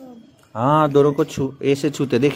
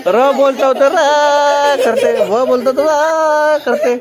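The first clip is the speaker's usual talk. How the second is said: slow, high, drawn-out bleat on रा and वाह; mock goat bleating; 8 semitones higher, 5 dB louder, slower than usual. Teacher teasing students who stretch letters, slow goat-like bleat. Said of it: "यहाँ बकरी है सब".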